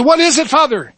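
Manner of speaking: high Irish brogue; Irish lad. Acted. Dying lad asks the rabbi, Irish accent. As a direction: ask eagerly in Irish lilt. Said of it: "switch between dialects here".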